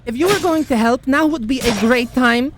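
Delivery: high, loud, fast call for help; urgent shout. Acted. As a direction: yell it across the battle. Calling for help mid-fight.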